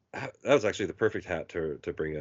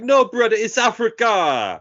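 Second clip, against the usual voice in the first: booming high-pitched street-character voice; imitated hat man; 11 semitones higher, 6 dB louder than usual. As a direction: exclaim it big, stretching the last word. Imitates old man at bus stop.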